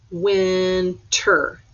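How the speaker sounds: "Winter" broken into win, ter; syllable by syllable. Shown as a demonstration. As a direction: give each half of "Winter" its own beat. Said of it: "count it out".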